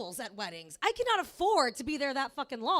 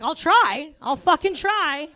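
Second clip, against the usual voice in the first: loud retort, drawn out at the end; exasperated mock yell; 14 dB louder, slower than usual. Acted reply to host's good wishes.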